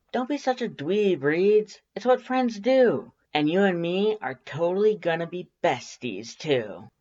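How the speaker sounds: high, bright insistence; playful scolding, then fond; Undyne's voice. A narrator's reading. Undyne telling her friend not to be a dweeb, playful.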